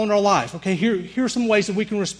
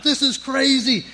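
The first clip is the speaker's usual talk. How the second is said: panicked scream; 8 semitones higher than usual. Retold or imitated. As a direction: yell it in panic. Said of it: "And I'm screaming".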